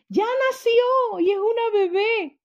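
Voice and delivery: alto y agudo, lleno de alegría; anuncio emocionado. Retold or imitated. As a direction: anuncia el nacimiento con júbilo. Anuncio feliz de un nacimiento. (anuncio jubiloso de nacimiento)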